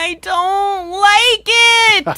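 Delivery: high, slow, whining cry; scared whine. Real, not acted. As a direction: whimper it out in fear. Scared of aquatic dinosaur.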